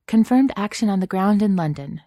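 crisp field report to the person in charge; authoritative. A narrator's reading. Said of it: "she said authoritatively".

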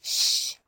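soft warning shush; a long, quiet "shh"; hushing. Acted. Karen keeps her secret from grandmother, hushing.

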